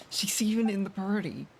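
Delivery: ordinary talk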